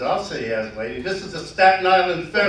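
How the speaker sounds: captain's punchline, loud and laughing; punchline shout. Acted. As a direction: slam the last line home over the laugh.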